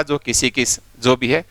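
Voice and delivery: ordinary talk, nothing marked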